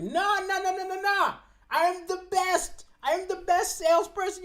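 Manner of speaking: high-pitched, stubborn insistence; defiant denial. Acted. Fired salesman protesting to his boss, high and stubborn.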